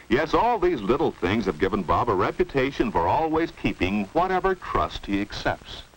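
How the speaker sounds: ordinary talk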